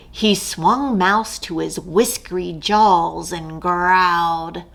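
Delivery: usual speaking voice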